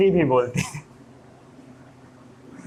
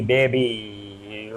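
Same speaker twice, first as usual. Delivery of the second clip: "Baby" softer, lower, last vowel stretched long; drawn-out joking "baby"; 9 semitones lower, 4 dB quieter, much slower than usual. Pretend. Teacher jokes it's not that kind of baby, drawn-out "baby". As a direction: let "baby" trail out long and low, in jest. वो वाली नहीं.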